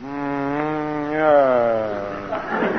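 low, soft, drawn-out musing; slow hesitant murmur; pondering. Acted. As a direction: stretch it out low while mulling it over. Stalling over the offered money.

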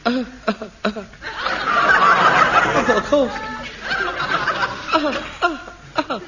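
feeble put-on little coughs; fake cough. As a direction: cough weakly to fish for sympathy. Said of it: "You call that a cough?".

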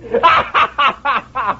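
high unhinged cackle; long high mad laugh; manic high laugh. Acted. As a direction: laugh like a freed madman. Madman laughs after killing.

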